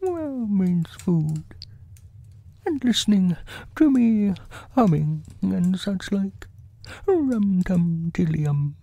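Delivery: high, simple bear voice; musing storybook bear. Acted. Bear working out what the hole means, high character voice. Voices Pooh reasoning aloud.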